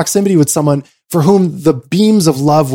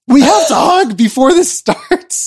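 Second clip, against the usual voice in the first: eager plea; 10 semitones higher than usual. Retold or imitated. Urging Tamori to hug.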